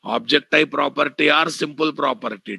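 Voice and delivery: normal talking voice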